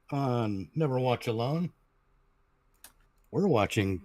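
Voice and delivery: normal talking voice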